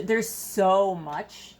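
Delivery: usual speaking voice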